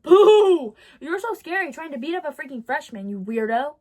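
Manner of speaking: a loud boo, then a taunt in the plain voice; mocking boo. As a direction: jeer at her like a crowd. Taunting the girl held back.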